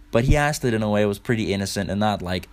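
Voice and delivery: ordinary talk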